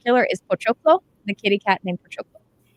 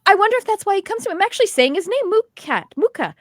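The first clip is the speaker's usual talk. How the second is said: amazed; 11 semitones higher, 4 dB louder than usual. Retold or imitated. Retold young woman's amazed realisation.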